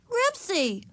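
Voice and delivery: put-on high, childlike call. A narrator's reading. Ramona calling the dog through the door, high.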